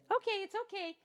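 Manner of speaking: soft high cooing to a cat; soothing the cat. Real, not acted. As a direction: coo gently to calm the cat down. Here's my cat yelling at me.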